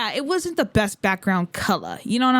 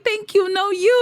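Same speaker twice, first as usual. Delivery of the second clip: his shy reply pitched higher; voicing the idol; 11 semitones higher, 4 dB louder than usual. Idol returns the fan's compliment, higher playful reply. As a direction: toss the compliment back, voiced higher.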